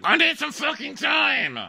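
very high, slow hammed-up yell; mocking Colton storming off; mock angry shout. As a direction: bellow it like a sulking diva. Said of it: "He's like".

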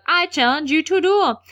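high, hammed-up declaration; mock challenge. Malfoy challenges Harry, mock grandiose.

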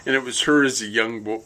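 ordinary delivery

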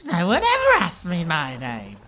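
very high, slow, swooning surprise; flattered Bella. Acted. Bella swoons when Saf asks her name. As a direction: melt at being asked. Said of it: "she's like".

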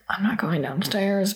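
scared whisper; hushed retold fear. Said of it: "I was getting really paranoid".

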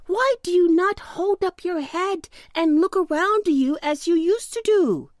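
high-pitched storybook questioning; sunbeams crying out. A narrator's reading. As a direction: call high to coax the little pumpkin. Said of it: "they cried".

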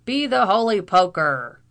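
very loud Irish oath; vociferating. A narrator's reading. Irish captain recognising the two arrivals, vociferating. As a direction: roar the oath out. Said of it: "vociferated the captain".

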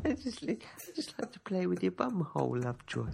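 a cheeky sidekick teasing his boss; squeaky Tinker. Pretend. Playing Tinker teasing Lovejoy.

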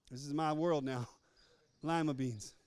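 husband stuck buying lima beans, resigned; mock resignation.

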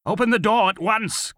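high, loud shouted command; shouting. Acted. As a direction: shout it through the door. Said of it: "Kroll shouted".

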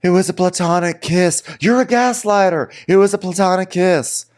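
high, whiny mock of a girl; mock high girly voice. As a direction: squeak it out like a defensive girl. Mocking Raquel's excuse.

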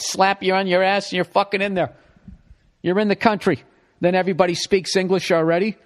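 ordinary talk

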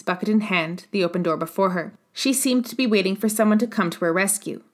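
ordinary delivery, nothing marked

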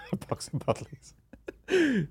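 repeats it through a high, slow laugh; giggling over the words. Real, not acted. Mocking repeat of the order.

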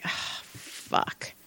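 soft, breathy dismayed curse; dismayed mutter. Retold or imitated. Massage therapist spots a new client on the schedule, dismayed mutter. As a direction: curse under your breath.

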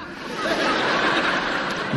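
airy laugh pitched high as the friend; high breathy laugh. Retold or imitated. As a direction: laugh along with the joke. Laughing at Daniel's joke.

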